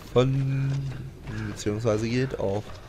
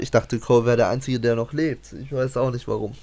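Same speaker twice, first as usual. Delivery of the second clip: usual speaking voice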